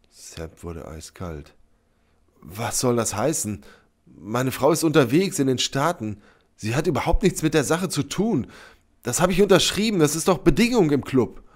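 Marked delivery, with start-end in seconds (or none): 2.4-11.4 building higher and louder in alarm; alarmed protest. A narrator's reading. Sepp insists his wife is uninvolved, alarmed. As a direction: defend yourself with mounting agitation.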